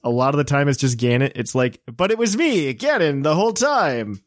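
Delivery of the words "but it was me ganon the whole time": higher-pitched, drawn-out mock villain; mock villain reveal. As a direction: drag out a hammed-up big reveal. Mock villain reveal, game plot.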